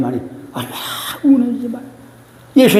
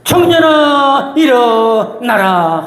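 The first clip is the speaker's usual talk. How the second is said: slow, drawn-out commanding call, louder and higher; Jesus raising the youth; 4 semitones higher, 3 dB louder, much slower than usual. Retold or imitated. Preacher voicing Jesus commanding the dead son to rise, slow and loud. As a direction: call the dead young man up slowly with authority. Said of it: "청년의 관에다 손을 대시면서".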